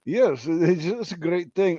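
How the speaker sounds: attempted royal British accent. Shown as a demonstration. Royal talking about the family, mock British accent. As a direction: try a hammed-up British accent. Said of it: "I can't do that".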